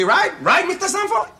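high, rising question; appealing for support. Acted. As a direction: turn and plead for agreement. Seeks backing after denying accent.

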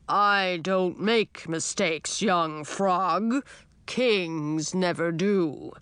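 put-on slow, rumbling old dragon; a grand old dragon, taking his time; old dragon king.

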